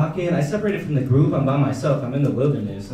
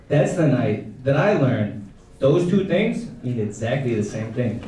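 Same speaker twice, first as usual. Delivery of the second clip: ordinary talk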